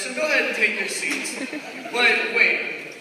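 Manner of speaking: ordinary delivery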